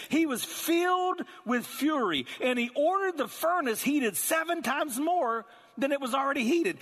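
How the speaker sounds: high, loud preaching of the fury; preaching at full force. Acted. Preacher thundering to the congregation about fury. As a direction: drive it home with rising heat.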